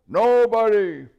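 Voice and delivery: high, loud hoot; hooted loudly. Acted. Owl loudly correcting the deaf dwarf. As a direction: hoot it at full volume.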